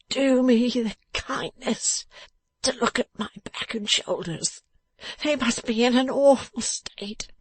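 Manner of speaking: high, slow, breathy whine; in anguish. A narrator's reading. Beaten man asking the dressmaker for help, in anguish. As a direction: plead feebly through the pain. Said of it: "sitting up in anguish".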